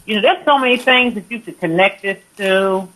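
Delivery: ordinary talk, nothing marked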